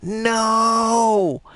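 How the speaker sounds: real panic at dying, slow and wailing; dismayed wail. Real, not acted. Dies in the game.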